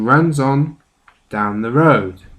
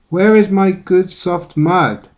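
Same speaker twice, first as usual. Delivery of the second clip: higher, louder storybook question; small pig demanding; 7 semitones higher, 5 dB louder than usual. A narrator's reading. Small pig asks where the mud went, demanding. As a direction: voice the small pig's upset, insistent question.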